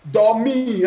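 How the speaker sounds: dormi said high and clear, split dor-mi; syllables spelled out. Shown as a demonstration. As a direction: break dormi into its two syllables. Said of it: "how many syllables does dormi have".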